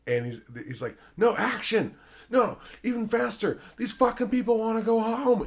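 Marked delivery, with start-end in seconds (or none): 1.2-5.4 high, urgent mock yell; retold director's yelling. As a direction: push the child actor, yelling orders. Director yelling at crying child actor.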